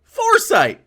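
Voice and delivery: high, loud cry of disbelief; incredulous correction. Real, not acted. Streamer correcting the video's word, incredulous shout.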